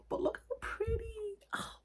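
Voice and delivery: high, drawn-out breathless coo; cooing admiration. Real, not acted. Showing viewers a pretty candle, high soft cooing. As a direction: gush softly, stretching the words out high.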